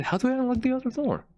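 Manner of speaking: sudden high, quick question; high frustrated question. Real, not acted. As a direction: ask it up high, exasperated.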